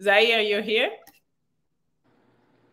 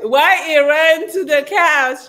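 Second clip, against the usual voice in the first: high, loud laughing question; cracking up; 6 semitones higher, 9 dB louder than usual. Real, not acted. Host laughing with co-host at a guest who ran off, cracking up. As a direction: say it loudly through laughter.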